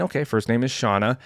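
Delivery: ordinary delivery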